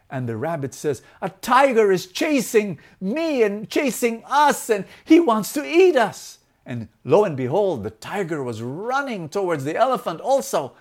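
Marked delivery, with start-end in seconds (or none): 1.2-6.4 high, loud cry of danger; acted alarm. Frightened rabbit warns the elephant, high urgent alarm. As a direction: sound the alarm, pitch climbing.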